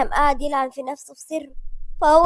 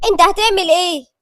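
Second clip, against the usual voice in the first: higher, louder, alarmed question; fearful question; 5 semitones higher, 7 dB louder than usual. A narrator's reading. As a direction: ask as if scared of his next move. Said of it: "ليليان ساعتها قالت بخوف". (سؤال بخوف)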